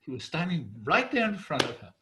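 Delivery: ordinary delivery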